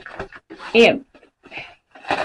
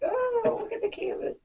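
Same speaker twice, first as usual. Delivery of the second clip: much higher, softer mock viewer voice; imitating worried viewer; 14 semitones higher, 9 dB quieter than usual. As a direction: mimic a fretting viewer lightly. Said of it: "Now you're probably like".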